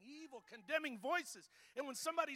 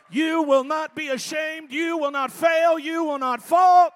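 slow, shouted declaration; full-force preaching; 3 semitones higher, 20 dB louder, slower than usual. Real, not acted. Preacher assuring the congregation they will not fail, slow preaching shout. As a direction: drive each phrase home, drawn out and loud.

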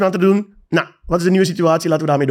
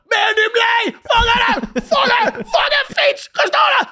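high, slow screaming rant; furious shout; slower than usual. Retold or imitated. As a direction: yell it across the street at the bike thief. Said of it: "begint te schelden".

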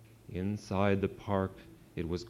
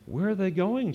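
high, louder alarmed question; 10 semitones higher, 4 dB louder than usual. A narrator's reading. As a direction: ask in sudden alarm. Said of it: "Chester asked with alarm".

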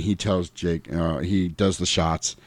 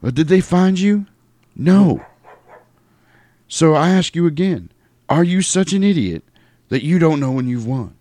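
the preacher; 8 semitones higher than usual. Retold or imitated. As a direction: press him like a preacher. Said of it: "I love it".

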